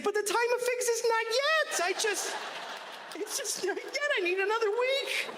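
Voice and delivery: very high, pleading whiny tree voice. Acted. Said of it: "And the tree's like".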